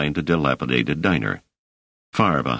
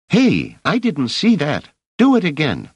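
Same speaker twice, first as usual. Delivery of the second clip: put-on high boy's voice, cheeky and disappointed; a scrappy street urchin egging her on; much higher slangy boy voice; street kid; 11 semitones higher, 4 dB louder than usual. Rache impressed by knife.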